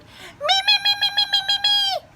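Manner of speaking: very high, eager repeated me; shouted eagerly. Acted. Froggy wanting to go first, very high eager shouting. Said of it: "Froggy shouted".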